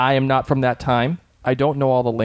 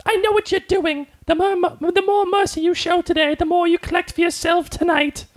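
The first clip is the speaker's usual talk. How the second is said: much higher, know-it-all mock Claire voice; sing-song it up high, smug and accusing; mock prim Claire. Claire accusing Dougal, mocked much higher and smug. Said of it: "she comes up to him and says".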